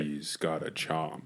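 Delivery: low, soft attempt at a villain; Thanos. Co-host trying the stoic villain himself, low soft impression. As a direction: drop the voice deep and quiet, half-hearted villain. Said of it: "I can't do it".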